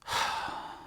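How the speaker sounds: long, breathy sigh; weary sigh. Acted. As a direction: let out a tired sigh. Worn-out narrator recalls long summer.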